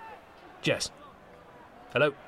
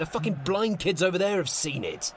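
higher, irritated rant; exasperated outburst; 4 semitones higher than usual. Acted. Answering a mate who asks if he saw the clip, exasperated. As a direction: snap back, pitched up and fed up. Teased about an embarrassing clip.

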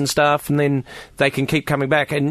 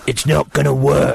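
mock rival comic; 4 dB louder than usual.

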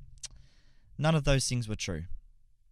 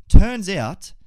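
dramatic reveal; 6 semitones higher, 16 dB louder than usual. Real, not acted.

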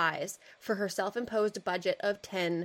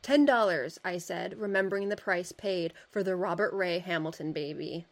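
put-on measured reading of an old article; steady reading-aloud voice; story narration. A narrator's reading. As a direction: read it like a period storyteller.

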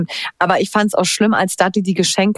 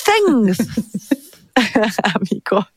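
lisping; slower than usual. Retold or imitated. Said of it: "und der so".

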